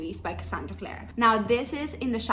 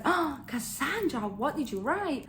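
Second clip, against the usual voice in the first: dismayed cry to author; 5 semitones higher, slower than usual. Retold or imitated. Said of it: "I was like".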